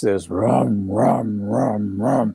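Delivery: slow rhythmic engine rumble, rum after rum; imitated heavy machinery. Witness recalling the craft's noise, imitated machinery rumble. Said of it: "you could hear this really, really heavy machinery".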